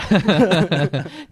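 big loud laugh bursting out; loud burst of laughter. Real, not acted. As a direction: laugh out loud, high and hearty. Laughing at the tweet.

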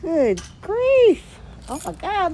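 amazed exclamation. Real, not acted. Amazed at the huge store.

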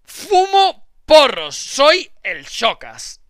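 slow, loud tough-guy drawl; mock tough guy. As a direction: ham up a stoned thug boast. Reading a mock tough persona.

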